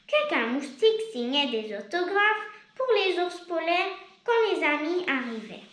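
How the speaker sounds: ordinary delivery, nothing marked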